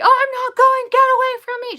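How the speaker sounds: very high, loud tantrum yell; mock screaming. Stepmom imitates boy kicking on floor, screaming. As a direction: imitate a child shrieking on the floor. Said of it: "just screaming".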